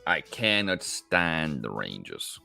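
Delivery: ordinary talk, nothing marked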